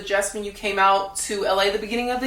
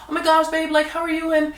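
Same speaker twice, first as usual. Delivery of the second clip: higher, gushing mock of a fake friend; fake sweet friend; 6 semitones higher, faster than usual. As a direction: imitate her sugary fake support. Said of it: "She'd always check in and be like".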